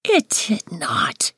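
slow, whispered protest; whispering child. A narrator's reading. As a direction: whisper a playful denial. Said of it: "she whispers".

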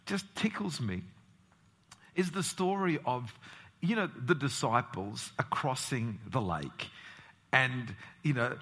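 ordinary talk

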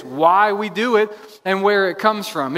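normal talking voice

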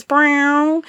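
a quick high vocal "brow" like a guitar stab; high imitated guitar twang; guitar riff imitation. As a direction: mimic the electric guitar riff with your voice. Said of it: "this electric guitar in the background do this riff".

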